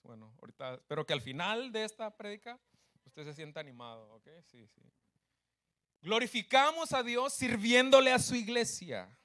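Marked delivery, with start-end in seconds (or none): 6.0-9.2 louder, higher proclaimed line; emphatic restatement. Real, not acted. Pastor proclaims the point to his congregation, ringing and emphatic. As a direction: ring it out for the whole church.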